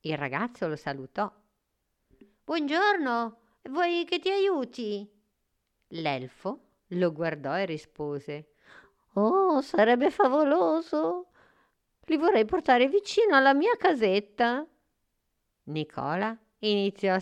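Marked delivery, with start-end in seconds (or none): 2.4-5.1 put-on high, friendly storybook boy; polite little boy, pitched well up; helpful boy. A narrator's reading. Boy greets elf, offers help. 9.1-14.6 high, loud little elf voice; excited fairy-tale elf; delighted elf. A narrator's reading. Elf gladly accepts the help.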